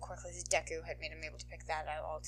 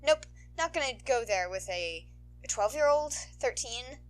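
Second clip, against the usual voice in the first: higher, wry self-correcting voice; heroine stopping herself; 5 semitones higher, 6 dB louder than usual. Acted. Heroine reining in her flirting, wry. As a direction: catch yourself mid-joke.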